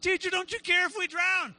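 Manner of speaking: high, urgent retold plea; mild mock panic. Disciples waking their teacher, urgently pleading. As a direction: cry out to rouse a sleeper.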